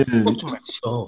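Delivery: slow and soft, swooning over the name; soft, dreamy. Retold or imitated. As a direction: breathe Touch my soul slowly. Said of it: "They said".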